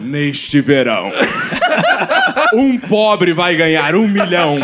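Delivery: slow, deep trailer announcer; movie trailer voice. Acted. Mock trailer narration.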